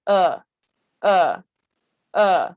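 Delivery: short, punchy "uh", lips neutral, jaw dropped; uh vowel demo. Shown as a demonstration. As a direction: say "uh" as a separate, open central vowel with relaxed lips. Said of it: "For this uh sound".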